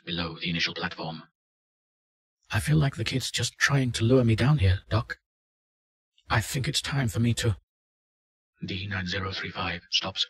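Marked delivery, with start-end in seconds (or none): 2.5-7.6 lightly acted unease, raised pitch; louder, higher voice of a reluctant subject; uneasy test subject. Subject tells the doctor he wants out, lightly voiced uneasy test subject.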